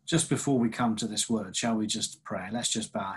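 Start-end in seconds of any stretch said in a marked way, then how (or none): none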